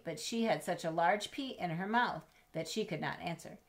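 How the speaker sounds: usual speaking voice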